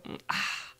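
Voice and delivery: breathy, satisfied "ahh" after a drink; refreshed sigh. Acted. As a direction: let out a thirst-quenched "mm, ah".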